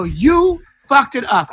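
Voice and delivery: high, slow accusation stretching YOU; mad, drawn-out retort. Retold or imitated. Comic repeats it at a Trump heckler, high drawn-out blame. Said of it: "I got so mad".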